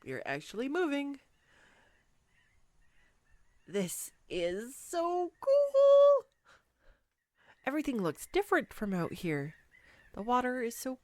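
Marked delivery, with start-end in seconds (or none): 3.6-6.2 slow, drawn-out, higher and louder wonder; real delighted awe. Real, not acted. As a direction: savour every word in delight. Boat starts moving.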